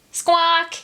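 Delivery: high loud seabird squawk; gull squawk. Acted. Gull's cry.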